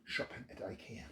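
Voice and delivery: breathy whispered tag line; soft hushed finish; whispered ending. Acted. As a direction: whisper the last words as a closing joke.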